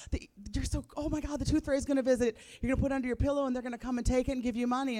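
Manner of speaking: high, rushed excited gush; acting out giddy, tumbling parental excitement; retold excitement. Mother tells her daughter about the tooth fairy, excited. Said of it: "I was so excited".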